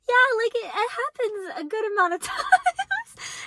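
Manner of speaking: very high, put-on voice breaking into a laugh; high squirming reply with a giggle; awkward admission. Awkward admission to her date, acted.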